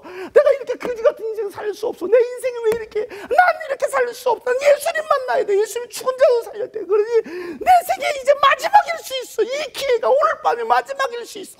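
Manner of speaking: high, loud tearful lament; a beggar's voice near tears; desperate pleading. Acted. Blind beggar pleading with himself, tearful and loud. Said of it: "하고서".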